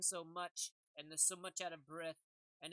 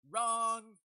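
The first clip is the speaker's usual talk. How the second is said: one word hurled out loud and flat; loud blunt correction; 3 semitones higher, 11 dB louder than usual. Acted. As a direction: cut them off loudly: wrong. Mock correction, sharply called out.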